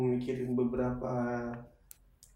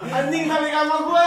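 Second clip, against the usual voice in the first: shouting over wind; 14 semitones higher, 9 dB louder than usual. Real, not acted. Wave reaches him, yelling.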